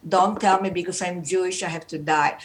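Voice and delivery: ordinary talk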